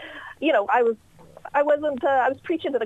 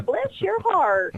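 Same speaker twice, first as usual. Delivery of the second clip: Southern "bless your heart"; 8 semitones higher, much slower than usual. Retold or imitated. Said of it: "you start getting the".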